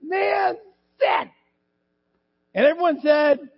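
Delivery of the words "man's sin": high, cracking cry building to a shout; desperate crying plea. Acted. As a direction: beg for your life through tears. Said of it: "they pleaded".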